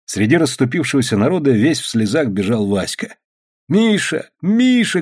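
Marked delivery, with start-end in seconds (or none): 3.7-5.0 put-on high-pitched boy's call; calling out, tearful role. A narrator's reading. Васька calling his bear, high-pitched cry. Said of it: "кричал он".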